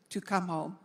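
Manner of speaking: normal talking voice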